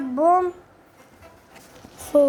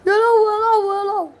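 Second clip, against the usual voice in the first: loud, high call across the map; shouting his name to get attention; 5 semitones higher, 5 dB louder than usual. Real, not acted. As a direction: yell for him to come back. Calling out to a teammate in game.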